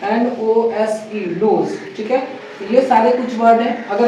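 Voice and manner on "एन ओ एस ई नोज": एन ओ एस ई dragged out slowly, then नोज; slow spelling. Shown as a demonstration. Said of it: "ये क्या कहते हैं". (धीरे spelling, फिर नोज)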